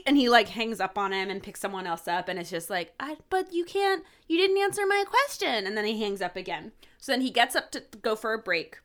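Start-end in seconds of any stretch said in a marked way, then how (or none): 3.0-5.7 put-on a kid insisting, pitched way up; young boy caller. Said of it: "a young sounding boy".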